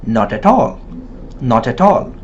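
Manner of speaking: "not at all" run together as "no-ta-tall"; linked native-like reading. Shown as a demonstration. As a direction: link each t onto the next vowel in "not at all". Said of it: "It is read as".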